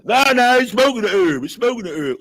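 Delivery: loud, high, insisting no; loud mock-prophet insistence; insistent correction. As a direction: jump in hard to correct him. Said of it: "Priest and prophet come along and say".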